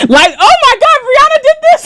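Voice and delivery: very high, incredulous outburst; amused shock. Real, not acted. Streamer learning what [name] did, shocked and high-pitched. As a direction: squeal it, breaking into laughter. Reacting to news about [name].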